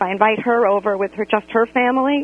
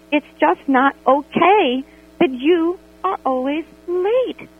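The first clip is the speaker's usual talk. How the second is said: slow, drawn-out "okay" and stressed "late"; emphatic confrontation; 7 semitones higher, slower than usual. Retold or imitated. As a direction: lean hard on the complaint. Confronting friend about lateness.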